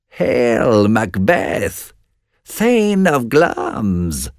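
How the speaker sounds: slow, high witch's hail; witch crying out. A narrator's reading. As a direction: stretch the words out like a witch. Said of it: "cried the first witch".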